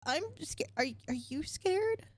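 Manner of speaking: put-on high, halting child voice; timid little boy. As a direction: stammer shyly in a small boy's high voice.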